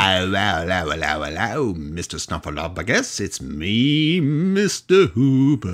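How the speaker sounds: put-on kids' show shopkeeper voice; Mister Hooper. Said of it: "He sounded".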